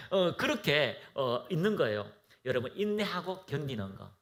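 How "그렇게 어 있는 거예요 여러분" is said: ordinary talk, nothing marked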